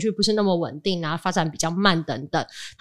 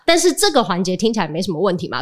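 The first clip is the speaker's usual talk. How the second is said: usual speaking voice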